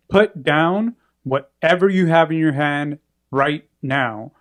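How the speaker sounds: slow, measured police command; cop voice. Retold or imitated. Cop ordering a man to drop the knife, cop voice. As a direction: space the words out like a cop's order. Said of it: "starts speaking in the cop voice".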